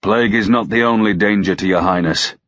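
ordinary delivery

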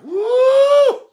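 high, loud whoop; preacher's whoop. Real, not acted. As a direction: let out a loud, high shout of joy. Preacher whoops after scripture.

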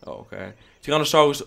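ordinary talk, nothing marked

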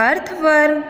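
teacher's high model અર્થવર્મ; English pronunciation demo. Shown as a demonstration. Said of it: "અંગ્રેજી ઉચ્ચાર થશે".

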